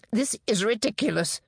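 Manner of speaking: flustered, angry outburst; snapped. A narrator's reading. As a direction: dismiss it with irritation. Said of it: "John snapped".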